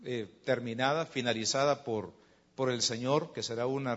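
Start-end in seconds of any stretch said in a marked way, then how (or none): none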